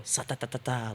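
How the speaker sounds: quick, soft stuttering sound effect; rapid hushed string of syllables; imitated bullet whizz. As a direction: mimic a bullet zipping past. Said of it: "they literally heard it go".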